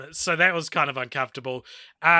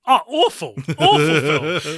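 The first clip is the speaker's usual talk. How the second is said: louder, slow, drawn-out awful; mock disgust; 6 dB louder than usual. Real, not acted. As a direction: ham up your disgust at the film. Asked opinion of the film.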